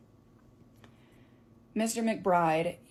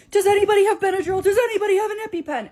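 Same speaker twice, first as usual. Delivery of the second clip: higher, louder, fast desperate call; frantic shout; 12 semitones higher, 10 dB louder, faster than usual. Retold or imitated. Young teacher shouting to strangers for Benadryl, frantic. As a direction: yell to the crowd for help fast.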